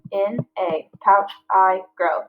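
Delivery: slow, each syllable spaced out; slow syllable-counting read. Shown as a demonstration. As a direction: clap out each beat. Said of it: "that's five syllables".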